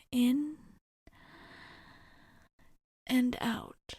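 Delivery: measured cues with long pauses; unhurried, spaced breathing count; guided breathing. Acted. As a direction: coach someone through calming breaths. Leads breathing exercise to calm someone.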